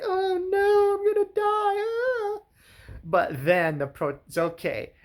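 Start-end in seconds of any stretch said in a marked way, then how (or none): 0.0-2.4 very high, wailing mock terror; mock panic. Mother holding her baby as a shark comes, in shrill mock panic. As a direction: squeal it out in hammed-up fear. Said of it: "she just holds the baby like".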